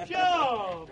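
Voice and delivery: loud, falling cry; loud exclamation. Acted. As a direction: burst out with one word. Scornful exclamation in acted tale.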